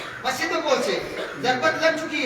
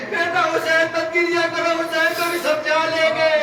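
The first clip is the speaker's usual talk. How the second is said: raised, chanted lament told to the mourners; mournful majlis recitation; 4 semitones higher than usual. Acted. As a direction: wail the story out as a grieving chant.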